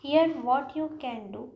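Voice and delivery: here what you can do said slowly; teacher modelling the correct phrase; slow model answer. Shown as a demonstration. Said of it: "क्या बोलना है".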